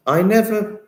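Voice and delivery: can't believe what you just read; surprised. Real, not acted. Shocked reaction to accident news.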